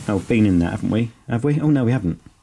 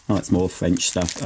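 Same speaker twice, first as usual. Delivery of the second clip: ordinary delivery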